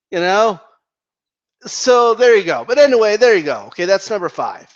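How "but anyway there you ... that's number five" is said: ordinary talk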